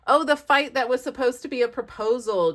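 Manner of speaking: ordinary talk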